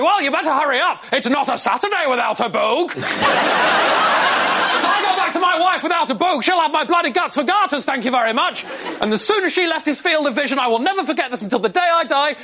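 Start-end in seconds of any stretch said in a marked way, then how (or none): 0.0-8.6 high, hammed-up bluster; all-in bluffing dad. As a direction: commit fully to a ridiculous lie. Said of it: "My dad went all in on the lie".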